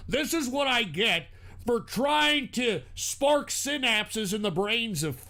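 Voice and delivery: ordinary talk, nothing marked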